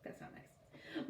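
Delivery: soft unvoiced slow aside; whispered aside. Real, not acted. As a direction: murmur a disapproving comment. Reader's aside.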